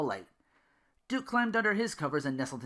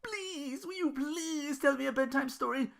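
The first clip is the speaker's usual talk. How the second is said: put-on whiny childish plea; slower than usual. Duke begging Belle for a bedtime story, high and whiny. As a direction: beg like a desperate little kid. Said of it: "his most desperate, whiny, and childish voice".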